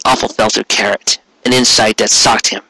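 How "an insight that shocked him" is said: usual speaking voice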